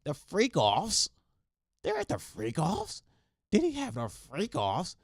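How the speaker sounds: high-pitched, drawn-out FREAK OFFS; over-accentuated mock shock. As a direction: stretch "freak offs" into a scandalized high question. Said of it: "accentuate the word and make it".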